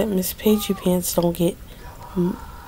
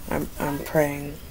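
hushed praying; 3 semitones lower, 9 dB quieter, slower than usual. Real, not acted.

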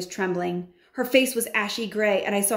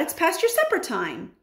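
calling out; 8 semitones higher than usual. A narrator's reading. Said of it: "she called out".

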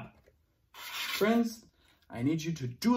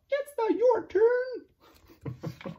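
high slow scolding; much slower than usual. Acted. Teacher scolds in story.

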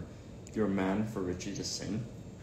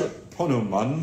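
higher-pitched mock British accent; jokey British accent, pitched up; 4 semitones higher, 4 dB louder, slower than usual. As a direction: play up the British accent with a laugh. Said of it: "Say it in a British accent".

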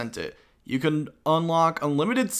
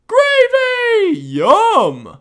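excited cry; 11 dB louder, much slower than usual. Pretend. Excited about gravy jawbreaker.

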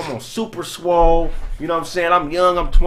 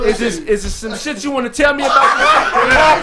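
stern confronting dad; 7 semitones higher, 4 dB louder, slower than usual. Retold or imitated. Father confronting son.

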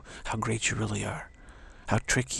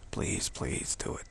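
mock whispered plea; 6 semitones lower, 8 dB quieter, slower than usual. Taunting plea to the ego.

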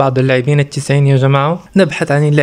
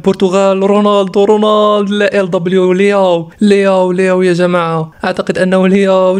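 excited pack reveal; 5 semitones higher than usual. Real, not acted. Streamer revealing a Portugal card to viewers, excited. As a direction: blurt out the names as the card flips.

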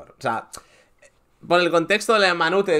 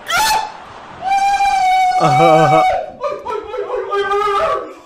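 helpless high-pitched cackle; can't hold the laughter in; high shrieking laugh; 5 dB louder than usual. Real, not acted. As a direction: burst into a squealing laugh. Host cracks up at clip.

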